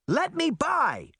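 high, slow shout through a crowd; girl shouting to get past. A narrator's reading. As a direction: yell to push through the crowd. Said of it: "she shouted".

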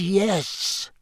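high, cold villain voice; cold master answering from the armchair. A narrator's reading. Cold villain speaking from his chair, high and cold. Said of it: "The cold voice was coming".

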